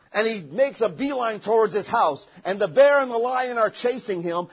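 ordinary delivery

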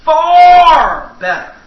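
Far better, drawn out slow, high and loud; emphatic. Acted. Preacher answering the amen corner, slow and loud. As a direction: lean hard on Far better. Echoes congregation, preaching climax.

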